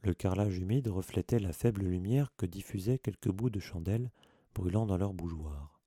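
ordinary delivery, nothing marked

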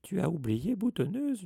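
high, sing-song tease, fully voiced; taunting aside. A narrator's reading. Peeves taunts Mimi at her ear, teasing. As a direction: needle her with a swooping lilt. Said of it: "lui souffla Peeves à l'oreille".

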